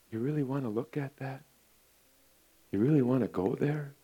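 the Holy Spirit whispering to Josh; soft whispered Spirit. Acted. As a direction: coax softly like a dove. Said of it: "That's the voice of the Holy Spirit".

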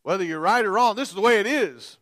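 loud, high imitation of insistent dads. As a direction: lay down the law at full volume. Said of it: "when we raise our voice".

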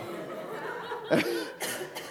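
quiet silent laugh after the question; hushed laugh at the memory; soft laugh. Real, not acted. Laughing at son's random question.

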